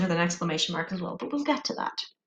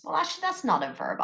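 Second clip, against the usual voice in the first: ordinary talk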